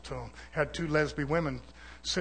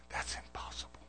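voiceless, very soft emphasis; hushed whisper; 14 dB quieter than usual. Real, not acted. Preacher denying the born again claim, hushed whisper. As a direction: drop right down to a whisper.

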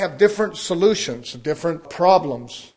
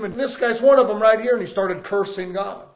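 usual speaking voice

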